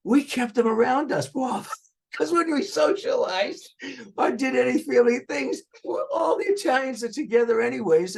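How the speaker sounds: high, laughing and gleeful; real laughing delight that ends in a shout; excited laughing. Real, not acted. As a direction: crow about it, laughing high. Laughing, gleeful family boast.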